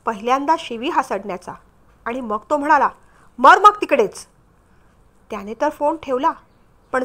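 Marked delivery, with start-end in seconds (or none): 3.4-4.2 loud, harsh dismissal; loud curse. A narrator's reading. Husband cursing his injured wife over the phone, loud and harsh. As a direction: spit it out loudly and cruelly. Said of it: "भोंग्यावर आवाज यावा तेवढा स्पष्ट आवाज".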